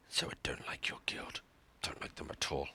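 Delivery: whispered aside, half-joking. Real, not acted. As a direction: mutter it low like a secret grudge. Secret grudge against rival guild.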